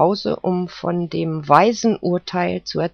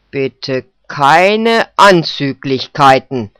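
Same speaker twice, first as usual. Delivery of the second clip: slow, louder rebuke; cutting off; 7 dB louder, slower than usual. Acted. Judge cuts off the poodle, slow and firm. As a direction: shut him down firmly, slow. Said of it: "schnitt ihm der Richter das Wort ab".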